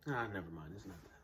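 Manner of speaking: soft, low self-dismissal; dismissive retreat. Acted. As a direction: back out of a confession, voice dropping away. Retracts a confession he began.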